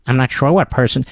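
ordinary delivery

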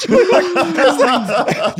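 slow, high words broken by laughter; laughing. Real, not acted. Host retelling the shiva visit, slowed and laughing. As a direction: laugh through it in disbelief.

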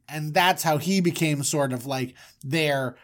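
ordinary talk

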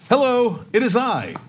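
totally serious. Retold or imitated. Man on a plane answering his phone, totally serious. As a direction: announce yourself formally, in mock seriousness. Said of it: "He was being totally serious, like".

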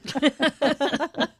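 giggling through an amused onlooker's remark; soft, laughing mock surprise; passerby amused at a screaming driver, laughing softly. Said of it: "you'll be like".

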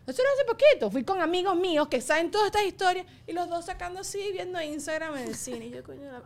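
ordinary talk

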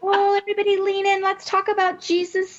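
high, sugary churchy voice, fast; mock sweet women's-event voice; Minnie Mouse voice. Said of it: "I call it the Minnie Mouse voice".

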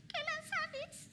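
retold husband's plea breaking into tears. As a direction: let the words crack on a held-back sob. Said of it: "se le quebró la voz".

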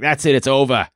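hammed-up Boston accent, R's dropped; mock Boston accent. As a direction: imitate a doom-saying fan in Boston accent. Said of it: "I can't even do that accent".

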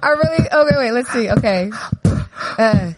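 breathless, laughing stalls; gasping before the rap; running out of air. Real, not acted. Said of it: "I'm running out of breath".

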